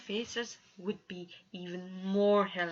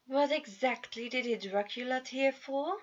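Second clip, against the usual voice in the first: bored; 5 semitones higher than usual. A narrator's reading. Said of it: "sounding like he didn't even care".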